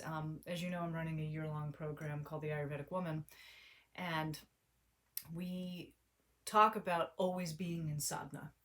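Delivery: ordinary talk, nothing marked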